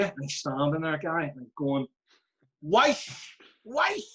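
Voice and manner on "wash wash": put-on high, barked "Wife" in Shankill Road accent. Player commanding his washing machine, mock Shankill Road accent. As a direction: imitate a thick Shankill Road "Wife", pitched up. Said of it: "the wee Shankill Road accent".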